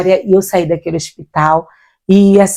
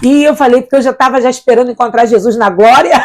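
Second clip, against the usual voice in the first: ordinary delivery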